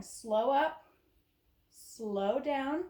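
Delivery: slow, lingering exercise count; drawn-out slow cue. Shown as a demonstration. Instructor pacing a shoulder raise, drawn-out. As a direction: stretch each SLOW with the movement.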